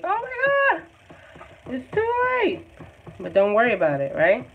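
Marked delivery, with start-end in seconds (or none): 0.0-2.6 very high, slow, drawn-out panicked squeal; mock freaking out. Teacher imitating students panicking over a too-light sky, mock squeal. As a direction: mimic a panicking student in a falsetto. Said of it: "you guys are probably freaking out like".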